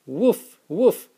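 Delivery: high, loud playful woof woof; cheerful doggy barks. Acted. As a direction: bark like a puppy for the kids. Dad barks for the dog.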